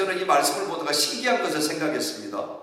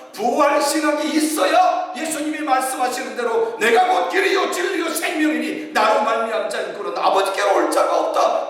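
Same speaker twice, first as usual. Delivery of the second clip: shouted preaching, much higher and louder; preaching at full force; 12 semitones higher, 3 dB louder than usual. Acted. Pastor climaxing the sermon to the congregation, shouting. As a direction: proclaim it with everything you have.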